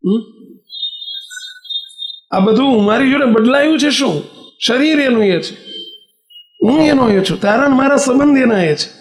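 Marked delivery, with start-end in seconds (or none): 2.3-8.9 hurt reproach. Retold or imitated. Brother confronts sister about wealth.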